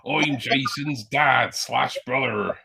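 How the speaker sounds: slow put-on pirate accent. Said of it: "Why have you done that accent for?".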